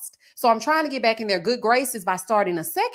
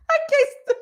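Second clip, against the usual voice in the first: high squeal of laughter breaking the words; cracking up too hard to finish; laughing fit; faster than usual. Real, not acted. Vlogger laughing at the cast mid-review. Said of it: "I can't stand them people".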